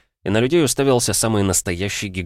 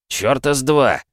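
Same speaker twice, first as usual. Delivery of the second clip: evil laugh; 6 semitones higher, 4 dB louder than usual. Acted. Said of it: "злобно расхохотался Голубев".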